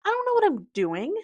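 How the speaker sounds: high, loud half-joking panic; mock panic. Real, not acted. Spiraling about almost turning thirty, high and loud. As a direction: wail it up high in mock despair.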